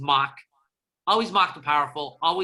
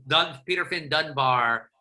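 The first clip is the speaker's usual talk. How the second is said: ordinary talk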